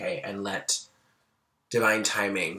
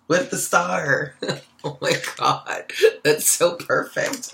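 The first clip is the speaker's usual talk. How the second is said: high and loud, with laughter; bubbling over with delight; giddy excitement; 6 semitones higher, 7 dB louder, slower than usual. Real, not acted. Reader sees cards line up, laughing excitedly. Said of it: "I'm getting all excited".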